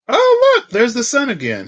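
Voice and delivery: high, loud happy exclaim; thrilled high cry; delighted. Real, not acted. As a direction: burst out with joy at the card. Card reveal delight.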